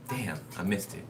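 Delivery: soft whisper under her breath; whispered aside. Real, not acted. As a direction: mutter a whispered regret. Aside to herself.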